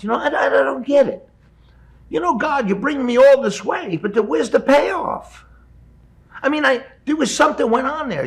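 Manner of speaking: exasperated. Retold or imitated. Complaining to uncle about God.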